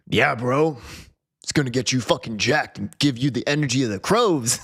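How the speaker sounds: hammed-up gym bro salesman; GNC bro. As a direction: pitch supplements like a pumped bro. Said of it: "he'd be like".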